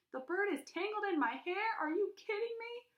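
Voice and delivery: high shrill panic; panicked shriek. Acted. Girl shrieking at her mother. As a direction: shriek in disbelief.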